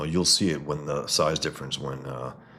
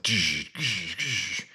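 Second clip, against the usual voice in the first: a buzzing gzz sound effect; sound effect demo; 3 semitones higher, much slower than usual. Shown as a demonstration. A comic artist showing viewers his sound effect, buzzing. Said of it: "I usually make these sounds like".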